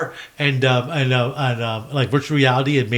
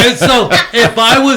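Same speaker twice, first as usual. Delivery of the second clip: loud laughing talk; 8 semitones higher, 9 dB louder than usual. Real, not acted. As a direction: laugh through the words. Laughing while talking.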